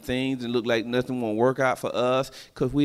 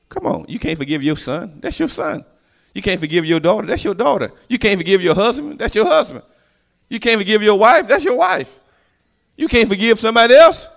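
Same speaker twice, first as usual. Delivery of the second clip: rising, louder and higher calls; building preaching; 7 semitones higher, 6 dB louder than usual. Acted. Preacher presses the congregation to forgive, building louder. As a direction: build it up line by line.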